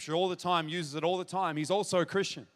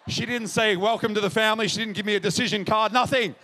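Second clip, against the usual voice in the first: loud, higher, punchy preacher's cadence; emphatic preaching; 4 semitones higher, 8 dB louder than usual. Real, not acted. Preacher tells what Mom didn't offer, emphatic preaching. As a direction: drive each denial home to the crowd.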